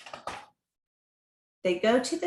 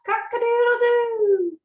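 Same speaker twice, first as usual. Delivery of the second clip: rooster crow; 13 semitones higher, 6 dB louder than usual. Acted. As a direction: crow it out like a proud rooster at dawn. Rooster crowing at day's end.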